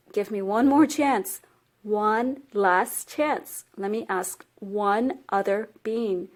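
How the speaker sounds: measured, drawn-out appeal for one last chance; slow pleading. Acted. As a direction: plead slowly, drawing out each word. Boy begs crocodile for his life.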